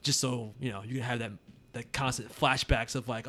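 ordinary talk, nothing marked

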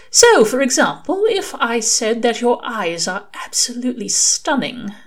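high, lilting angel voice; Aziraphale, flirting. A narrator's reading. Aziraphale tries flirting with Crowley, lilting.

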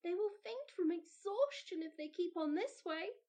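put-on high, worried child's thought; a small girl fretting for the dancers; Mildred worries to herself; worried little girl. A narrator's reading. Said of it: "thought Mildred".

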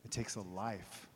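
soft, intimate reflection; quiet sermon close. Acted. Preacher closes quietly to the congregation, soft and intimate.

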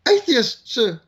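a nervous recruit owning up; hesitant answer. Retold or imitated. Recruit answering the sergeant major, timid and high. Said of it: "said in a hesitant voice".

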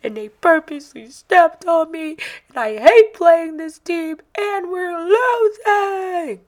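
put-on high, loud sobbing little boy; crying child. Son tells mom he was pushed, high crying voice. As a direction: wail it like a hurt seven-year-old. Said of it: "then he tells me".

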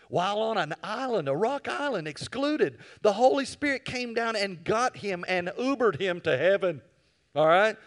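high, loud and building; rising preaching. Real, not acted. Preacher telling church of John's vision, loud. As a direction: preach it to the rafters.

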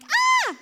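sudden high, loud scream; short piercing shriek of fright; mum's scream. Retold or imitated. Mum startled by a knife while cooking, high loud scream. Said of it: "would scream".

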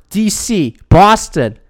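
normal talking voice

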